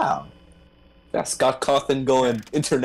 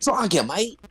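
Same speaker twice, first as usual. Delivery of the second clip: higher-pitched Australian impression; mock Australian accent; 8 semitones higher than usual. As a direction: put on a playful Aussie accent. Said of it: "should have given him an Australian accent".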